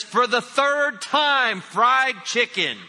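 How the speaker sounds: drawn-out annoyed shout with a laugh; exasperated yell. Retold or imitated. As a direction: spell it out loud to a husband who never listens. Wife exasperated, third time asked.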